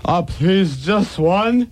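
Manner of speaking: put-on high whiny drunk plea; whining. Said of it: "whined the bum".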